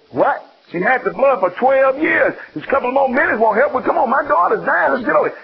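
high-pitched, impatient urging; impatient father. Retold or imitated. Jairus pressing Jesus to hurry, high and impatient. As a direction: hurry him along, frustrated. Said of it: "And he goes".

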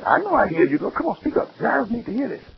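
high, soft coaxing; coaxing Jesus. Retold or imitated. Jesus coaxing the woman to speak up, high and soft. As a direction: gently urge her to speak.

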